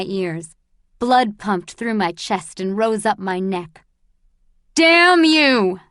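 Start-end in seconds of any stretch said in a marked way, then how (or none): 4.7-5.8 much higher, loud furious yell; furious scream. Acted. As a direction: explode at the old man. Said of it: "Andrus screamed".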